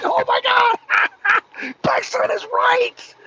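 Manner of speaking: high-pitched mock crack-up; imitated laughing disbelief. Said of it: "You gotta laugh like".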